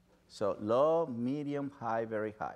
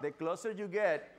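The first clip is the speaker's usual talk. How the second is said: ordinary talk, nothing marked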